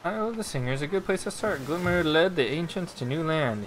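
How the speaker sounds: a touch of storybook narration; reading a riddle aloud. Acted. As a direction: read the clue like an old inscription. Reads clue text aloud.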